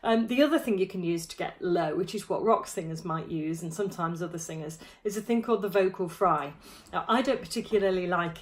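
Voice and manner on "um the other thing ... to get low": ordinary talk, nothing marked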